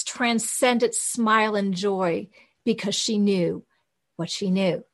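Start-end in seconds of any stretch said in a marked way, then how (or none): none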